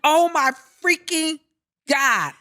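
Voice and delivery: high, drawn-out cry of disbelief; retold alarm. Telling friends about a missing car, in retold alarm. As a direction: stretch each word out, high, in retold shock. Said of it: "And I'm like".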